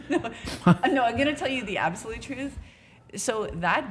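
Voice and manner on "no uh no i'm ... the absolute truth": a laugh running through the promise; chuckling, half-serious vow. Real, not acted. Laughing while promising the truth.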